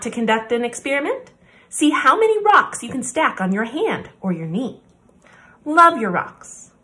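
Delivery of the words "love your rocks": usual speaking voice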